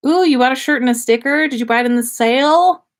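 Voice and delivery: ordinary delivery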